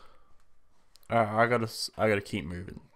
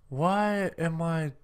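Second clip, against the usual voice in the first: slowed despair; 4 semitones higher, slower than usual. Real, not acted. Character slowing, despair.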